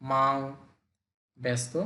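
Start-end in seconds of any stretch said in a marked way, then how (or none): 0.0-0.3 মাং rising upward; মাং with a rising second tone; Mandarin second tone. Shown as a demonstration. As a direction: lift মাং up. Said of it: "উপরের দিকে উঠে গেছে".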